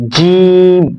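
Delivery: জিম punched out loud and firm; hard letter demo. Shown as a demonstration. Teacher showing students the hard জিম, loud and firm. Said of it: "শক্ত".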